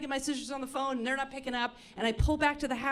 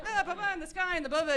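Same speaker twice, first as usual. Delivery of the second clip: evasive babble; 6 semitones higher, faster than usual. Retold or imitated. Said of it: "danced around it as an entertainer would".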